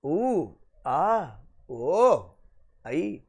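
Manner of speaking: garbled mock student sounds; lightly mimicked stumbling; mumbled mock stumbling. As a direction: fumble the word like a confused student.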